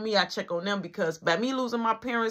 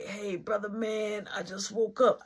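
much softer, sleepy voice; just woke up; 6 dB quieter, slower than usual. Retold or imitated. Gerard answering after waking up, soft and sleepy.

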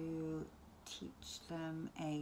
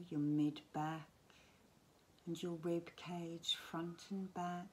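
guided relaxation. Acted. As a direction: gently talk them down towards sleep, phrase by phrase. Leads a bedtime relaxation.

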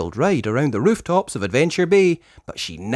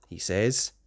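plain reading voice

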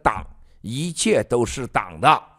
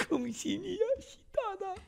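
much higher, much softer simpering voice; mock fawning American; 10 dB quieter than usual. Mocking an old American executive fawning, high soft voice.

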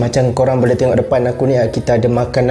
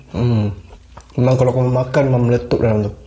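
talking while chewing; muffled chewing speech; mouth full of food; 3 semitones lower than usual. Real, not acted. Seen 2: Bites food at 0.1, then visibly chews while talking throughout.

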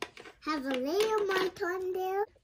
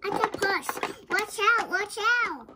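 warning shout; 4 semitones higher, 6 dB louder, faster than usual. Pretend. Warning of an octopus.

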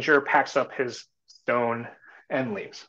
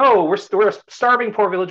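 higher, louder plea of poverty; starving villagers; 7 semitones higher, 5 dB louder than usual. Retold or imitated. Villagers turning the stranger away, pleading. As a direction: insist the village has nothing.

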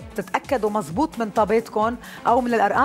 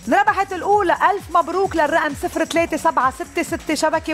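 متحمس; 6 semitones higher than usual. Real, not acted. Announcing the jackpot was won.

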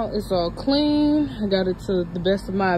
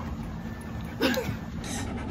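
breathy laugh; 8 dB quieter than usual. Real, not acted. Laughing at the dog.

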